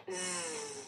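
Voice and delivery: ज buzzed low, no vowel; buzzed Z sound. Shown as a demonstration. Teacher shows Z as buzzing ज. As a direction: buzz a low ज. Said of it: "ज नहीं बोलना है इसको".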